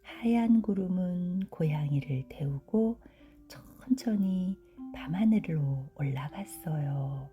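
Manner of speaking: ordinary delivery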